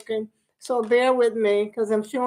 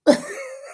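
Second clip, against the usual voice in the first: high burst of laughter; 11 semitones higher than usual. Real, not acted. Laughing at own stumble.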